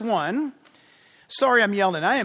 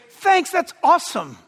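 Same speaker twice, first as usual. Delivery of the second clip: acted thanks, higher than usual; grateful thanks; 5 semitones higher than usual. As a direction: voice someone's appreciative, higher-pitched thanks. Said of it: "they turn and they say".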